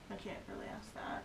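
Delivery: hushed fast aside; whispering. Real, not acted. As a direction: whisper it quickly under your breath. Aside whispered during session.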